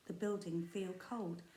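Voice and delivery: ordinary delivery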